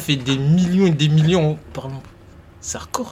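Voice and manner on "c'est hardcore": whispering. Real, not acted. Hushed reaction to Mr Bean.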